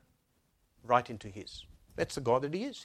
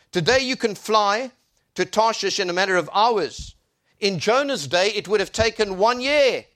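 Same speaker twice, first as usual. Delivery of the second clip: loud, higher preacher's delivery; impassioned preaching; 9 semitones higher, 10 dB louder than usual. Real, not acted. Preacher declaring God's word to the congregation, impassioned preaching. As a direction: proclaim it with full force.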